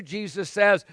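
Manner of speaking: ordinary talk, nothing marked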